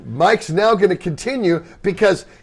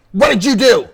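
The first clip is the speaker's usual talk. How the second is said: higher mock demand; retold confrontation; 5 semitones higher, 4 dB louder than usual. Tony confronting bandmate on the bus, retold accusing demand. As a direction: imitate someone demanding an explanation.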